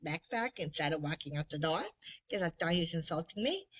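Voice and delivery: usual speaking voice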